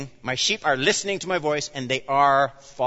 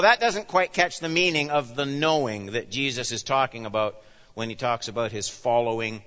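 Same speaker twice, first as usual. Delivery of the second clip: ordinary talk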